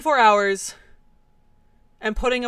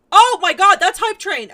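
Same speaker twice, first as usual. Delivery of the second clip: excited shout; 7 semitones higher, 11 dB louder than usual. Real, not acted. Streamer shocked by hype train.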